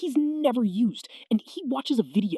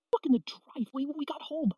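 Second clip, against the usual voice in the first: ordinary delivery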